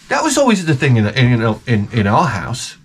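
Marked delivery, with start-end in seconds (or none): none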